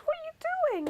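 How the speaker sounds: very high, sing-song mother's voice; mum's question. Acted. Mum asking her son why he backs off. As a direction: ask like a surprised mum.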